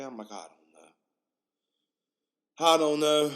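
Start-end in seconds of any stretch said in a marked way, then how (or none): 0.3-0.9 low, very soft mumble; barely-there low reply; subdued answer. Retold or imitated. Retold answer, then repeated louder. 2.6-3.3 raised, loud reply; emphatic, raised-voice reply; louder answer. Retold or imitated. Same answer repeated louder.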